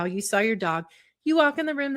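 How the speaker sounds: ordinary talk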